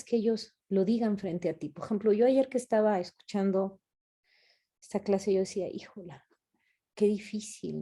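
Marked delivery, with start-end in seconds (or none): 5.7-7.8 near-silent dismay, said to herself; dismayed under breath. Retold or imitated. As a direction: let it slip out almost unheard. Yo decía oyendo la clase. (desánimo casi en silencio, para sí misma)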